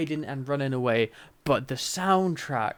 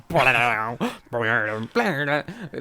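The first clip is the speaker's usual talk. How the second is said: imitated sound effect. Mocking the episode's intrusive action sound effects, imitated noises. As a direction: blurt out a goofy sound effect. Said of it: "I was just waiting for the".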